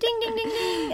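high, ringing ding-ding-ding; imitated quiz bell. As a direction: chime it high like a game-show bell. Mimics quiz bell for right answer.

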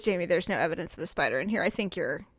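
quick, low, soft dismissal; imitated unconvinced husband, hushed and quick; sceptical husband. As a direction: play it down under your voice, low.

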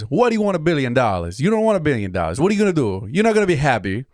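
a hint of a scolding Italian grandpa; terrible grandpa impression. Grandson voicing his grandfather as a genie, lightly scolding. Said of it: "my dumb, terrible impression of him".

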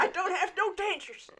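gummed words with no teeth; lips over teeth. Acted. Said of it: "She doesn't have any dentures".

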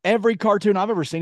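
normal talking voice

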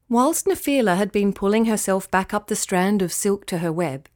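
plain reading voice

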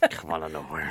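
soft whispered reaction. Real, not acted. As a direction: whisper it back, half amused.

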